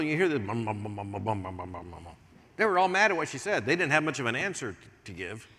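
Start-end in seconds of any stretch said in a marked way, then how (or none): 0.4-2.1 low droning bum bum of a grumbling crowd; imitated grumbling. Preacher imitating the House of Commons grumbling, low drone. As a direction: mimic the murmur of Parliament grumbling. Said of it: "they would just grumble".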